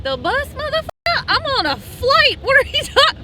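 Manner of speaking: high, loud, slowed indignant rant; hammed-up disbelief at being bussed; mock outrage. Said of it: "I was like".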